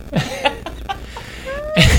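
high laugh at his own story; bright laugh. Real, not acted. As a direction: burst out laughing.

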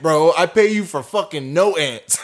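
loud, high, whiny complaint; mock a petty client; entitled customer voice.

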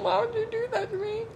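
high, pitiful mock whine. Daughter to mom about being German, high mock whine. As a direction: plead like a wronged child. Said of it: "And I was like".

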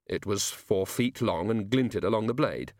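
plain reading voice

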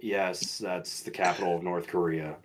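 low, soft, bored teacher drone; teacher flatly confirming the obvious answer; dry teacher voice. Retold or imitated. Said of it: "She'd be like".

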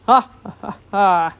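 loud spelled-out royal laugh; hearty laugh. A narrator's reading. King laughing at the boy's confusion, big laugh. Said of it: "Neptune burst out laughing".

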